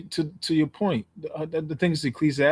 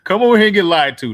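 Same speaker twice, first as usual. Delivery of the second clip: joking call; 5 semitones higher, 10 dB louder than usual. Pretend.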